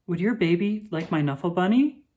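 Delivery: ordinary delivery, nothing marked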